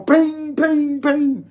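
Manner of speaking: high sung-out ping ping ping, like a cymbal; cymbal ping sound. Retold or imitated. Mocking head bangers with a cymbal ping. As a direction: mimic the cymbal ringing with your voice.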